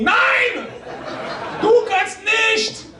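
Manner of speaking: high, slow stern German voice; German accent. Pretend. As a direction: shout it like a strict German conscience. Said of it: "ma conscience ... elle me dit".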